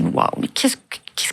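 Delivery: breathless whispered gasp of shock. Retold or imitated. Mother hearing her daughter's phrase, stunned whisper. Shocked inner thought, retold.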